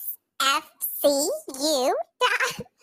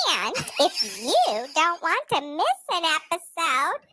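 normal talking voice

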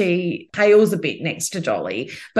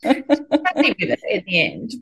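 usual speaking voice

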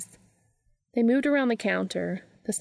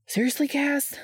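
higher-pitched, exasperated question; fed up and incredulous, voice pitched up; 7 semitones higher than usual. A narrator's reading. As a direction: ask it in exasperated disbelief.

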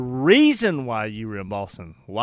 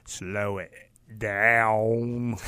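slow, smooth radio-DJ delivery, sinking lower; 5 semitones lower, 4 dB quieter, much slower than usual. Shown as a demonstration. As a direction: drag it out slow and low like a smooth DJ. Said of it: "so smooth, 'cause they slowed it".

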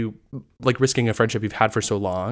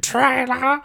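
loud sharp voice; 12 semitones higher, 4 dB louder than usual. Pretend. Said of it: "I thought that was fantastic".

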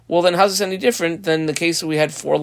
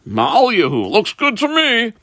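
high-pitched, lightly acted verdict; rabbi's breezy approval; 8 semitones higher than usual. Rabbi answers the man showing a coin, high-pitched approval. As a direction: voice an approving quote, pitched well up.

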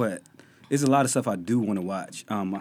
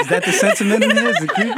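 laughing; 12 semitones higher, 11 dB louder than usual. Real, not acted. Teasing about her creepy voice.

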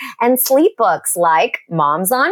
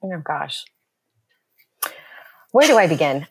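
ordinary talk

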